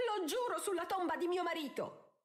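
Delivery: high-pitched, vehement shriek; shrieking oath. A narrator's reading. Countess swearing on her husband's tomb, shrieking. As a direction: swear it in a high, indignant cry. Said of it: "strillò Araminta".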